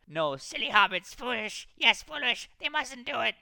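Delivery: high, loud creature scolding; frightened, angry creature voice; shrieking. A narrator's reading. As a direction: shriek in fear and anger. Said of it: "thin hissing shriek frightened and angry".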